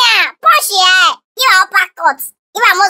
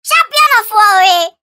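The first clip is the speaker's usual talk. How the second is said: higher, excited hype man taunt; hyped taunting; 6 semitones higher than usual. Acted. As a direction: pump up your champion and mock the rival. Taunting rival, hyping champion.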